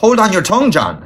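high, sharp snapped order; sharp rebuke. A narrator's reading. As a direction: rap it out sharply. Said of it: "his father rapped out".

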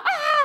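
very high startled "ah"; imitated haunted-house shriek; mock jump-scare cry. Another hantu comes out.

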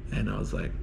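ordinary talk, nothing marked

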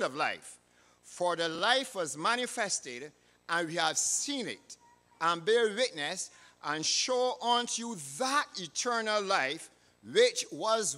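deliberate, emphatic Bible reading; scripture reading. Real, not acted. Preacher going over the scripture reading again, solemn proclamation. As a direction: proclaim each phrase firmly, pausing between clauses. Reads scripture aloud to congregation.

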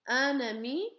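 "un ami" linked, nasal un; un with liaison. Shown as a demonstration. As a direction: say "un ami" with the N joining ami. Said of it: "the pronunciation will change".

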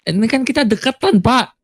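officer protests being told to step back; indignant protest. Acted. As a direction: object in disbelief, stretching each step.

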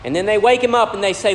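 usual speaking voice

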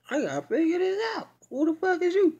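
high, loud, furious confrontation; angry demand. Retold or imitated. Sister confronting the stranger who claims kinship, furious high demand. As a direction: snap it out, high and outraged. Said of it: "So she like".

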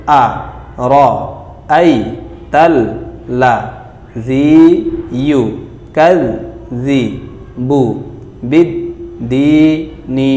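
each syllable apart, with pauses; broken syllables. Shown as a demonstration. Teacher to class, syllable by syllable. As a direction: spell it out syllable by syllable. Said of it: "আবার দেখুন".

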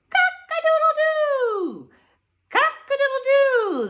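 loud, high, slow crowing; rooster crow. Shown as a demonstration. Teacher crowing like a rooster to the kids. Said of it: "meet the rooster".

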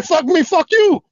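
high, slow jeering parody of Lisa; mocking Lisa. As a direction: drag her words out in a high taunting voice. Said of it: "Don't say".

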